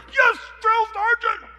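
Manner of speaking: very high shouted recruit reply; barked military recruit reply. Retold or imitated. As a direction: bark back like a boot camp recruit. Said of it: "my old drill instructor".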